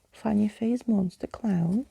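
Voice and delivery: usual speaking voice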